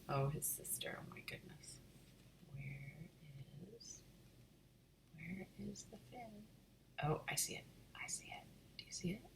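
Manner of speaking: hushed pointing whisper. Real, not acted. Reader whispering asides.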